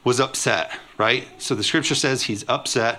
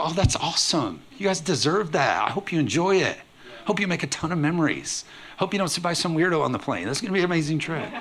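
higher, bright congratulations; genuinely happy for them; 6 semitones higher than usual. Pretend. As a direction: cheer them on with delight. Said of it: "You go".

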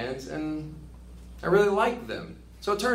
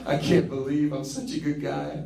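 laughing smugly. Pretend. Mocking a self-satisfied do-gooder.

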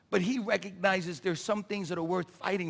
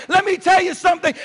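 loud, high preacher's call; shouted; 11 semitones higher, 13 dB louder than usual. Shown as a demonstration. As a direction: shout it out to the congregation. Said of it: "shouted preaching take".

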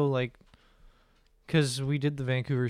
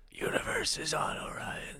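whispering; 6 dB quieter than usual. Pretend.

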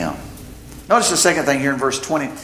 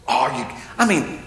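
ordinary delivery

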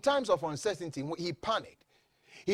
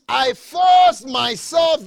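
slow, loud, raised quotation; emphatic declamation; 8 semitones higher, 13 dB louder, much slower than usual. Real, not acted. Preacher quoting Saul from the King James, emphatic declamation. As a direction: hammer out each word slowly.

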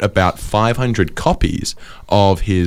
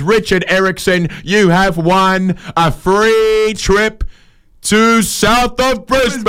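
mock prize announcement; 9 semitones higher, much slower than usual.

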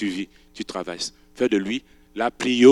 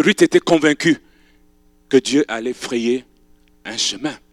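ordinary talk, nothing marked

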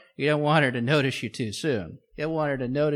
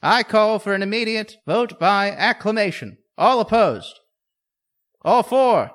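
loud, higher formal call to vote; booming chairman; 7 semitones higher, 9 dB louder than usual. Acted.

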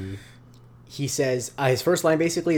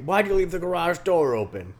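higher mock neighbor without the New York accent; lightly voiced grumpy dad; less New York; 6 semitones higher than usual. Redoing the neighbor's line, toned-down mock dad. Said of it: "Your Leland is so good".

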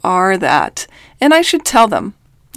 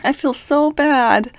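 mimicking distressed sister; 6 semitones higher than usual. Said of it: "it's my sister in Utah".

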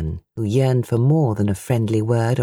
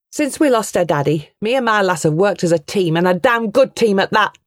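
proud anger; 5 semitones higher than usual. A narrator's reading. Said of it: "Pride and anger swelled her voice".

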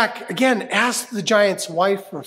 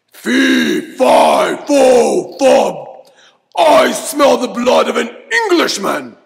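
booming giant; 4 semitones lower, 6 dB louder than usual. Acted. Giant bellowing to smell out Jack, loud booming voice. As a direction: thunder it out like a hungry giant. Giant's chant, class joins in.

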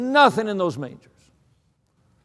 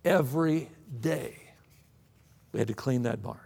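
much softer and lower, heavy; hushed emphasis; 8 semitones lower, 11 dB quieter than usual. Acted. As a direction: drop to a near hush. Drops voice for weight.

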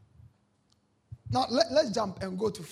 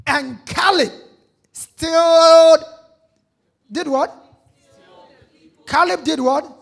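loud, high-pitched preacher cry; preaching shout; 6 semitones higher, 15 dB louder, slower than usual. Acted. Preacher stressing Caleb to the congregation, loud and high. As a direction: ring it out over the congregation.

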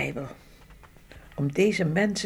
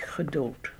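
whispering; 3 semitones lower, 8 dB quieter than usual. Real, not acted. Solemn news of the killings.

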